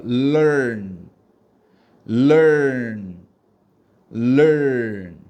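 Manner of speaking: LEARN as 럴 flowing unbroken into 언; long, loud learn with the two syllables blended; smoothly joined syllables. Shown as a demonstration. Teacher asks students to join two syllables, smoothly blended learn. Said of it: "부드럽게 두 글자를 이어서 발음한다고".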